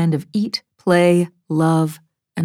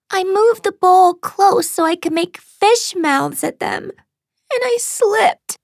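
put-on very high small-child voice; tearful little girl; slower than usual. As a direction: confess like an upset child after a sob. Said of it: "She gulped down a sob".

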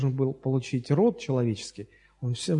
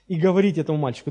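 ordinary delivery

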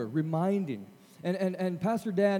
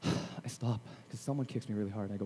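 usual speaking voice

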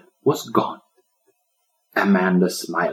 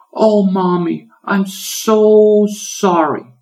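high, very slow tearful apology; little girl crying to her mother, high and slow; breaking into tears; 12 semitones higher, 7 dB louder, much slower than usual. A narrator's reading. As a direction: sob it out to mom. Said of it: "she broke down in tears".

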